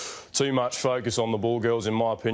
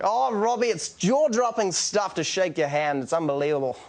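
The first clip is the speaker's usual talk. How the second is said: Koenig South African voice; 7 semitones higher than usual. Retold or imitated. Greeting Koenig in his own South African voice, high excited. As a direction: gush in South African commentator style, pitched up. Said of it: "was like".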